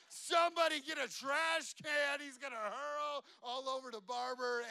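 dad at the barbershop yelling for a trash can as his son gags; frantic shout. Retold or imitated. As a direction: yell for help across the room.

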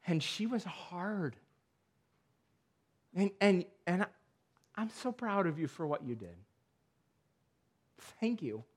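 soft words of heartfelt pride; tender praise. Pretend. Jesus praises adoption softly.

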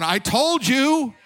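slow, high, loud told-you-so. Retold or imitated. Pastor retorting to parents.

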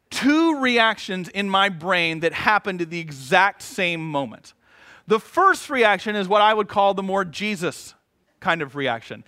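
ordinary talk

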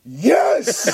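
loud, drawn-out ecstatic yes; imitated moviegoer outburst. Dude in front at the trailer, exultant outburst. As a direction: break the hush with a big yes. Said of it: "And a dude goes".

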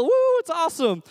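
high, whooping dad celebration; party whoop. Retold or imitated. Dad teaching his toddler it's a party, excited whoop. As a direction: cheer it with a high "woo". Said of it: "It's a party. You go".

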